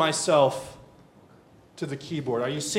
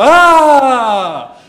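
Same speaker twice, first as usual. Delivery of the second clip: long, high scream falling away; imitated toddler cry; 10 semitones higher, 18 dB louder than usual. Father mimics one-year-old reaching for him, screaming out. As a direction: wail at full volume like a toddler. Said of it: "he's speaking in tongues".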